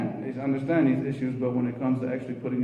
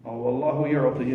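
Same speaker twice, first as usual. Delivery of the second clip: normal talking voice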